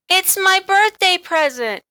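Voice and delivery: put-on high, loud proud girl; little girl proudly showing her present. A narrator's reading. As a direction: show off the present.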